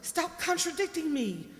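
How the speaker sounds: loud, fast scolding; stern scolding. Acted. Mother scolding Helen for shaking her head, loud and fast.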